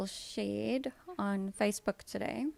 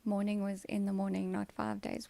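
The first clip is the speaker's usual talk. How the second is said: ordinary delivery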